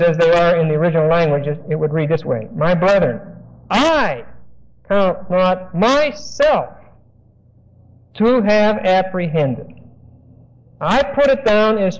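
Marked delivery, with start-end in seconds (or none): 2.5-9.8 slow, weighty stress on "I" and "count not myself", each word set apart; emphatic slow reading. Shown as a demonstration. Preacher reading Paul with original-language emphasis, slow and weighty. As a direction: lean hard on "I", then draw out "count not myself to have apprehended". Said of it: "if we were to put the emphasis".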